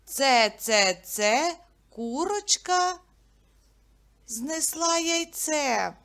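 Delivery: nursery rhyme chant, drawn out; sing-song rhyme. Shown as a demonstration. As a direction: chant it playfully for little kids. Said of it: "Я вам буду називати початок".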